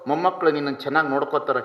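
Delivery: fast, low questioning; asking the weeping old woman quickly and low; quick concerned questions. Retold or imitated. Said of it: "ನಾ ಕೇಳ್ದೆ".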